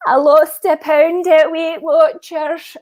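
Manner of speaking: put-on high, very slow Scottish accent; repeated Scottish impression. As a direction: redo the Scottish mum voice, drawn out. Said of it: "they made me repeat the whole thing".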